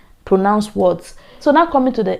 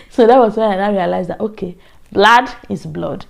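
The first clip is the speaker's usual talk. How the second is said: ordinary talk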